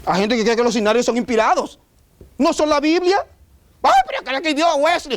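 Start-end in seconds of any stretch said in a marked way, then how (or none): 3.8-5.2 high put-on voice defending Wesley's hymn; whiny objector. Pastor mocks a churchgoer defending Wesley, high whine. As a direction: play the offended hymn lover, pitched high. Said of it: "¿Qué, qué importa?".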